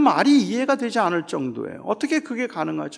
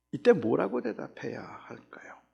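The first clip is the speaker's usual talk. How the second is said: whispered, hushed question to the congregation; 6 semitones lower, 8 dB quieter than usual. Real, not acted. Asks listeners hushed, rhetorical. (속삭이듯 묻는 질문)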